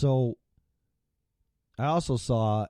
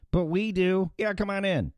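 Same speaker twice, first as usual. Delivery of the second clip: high, sing-song mock welcome; sarcastic mock welcome; 10 semitones higher than usual. Vlogger mocks politicians welcoming migrants, high sarcastic sing-song. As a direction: chirp it like a fake-cheery host.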